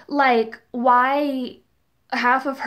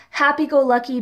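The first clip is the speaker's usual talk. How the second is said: normal talking voice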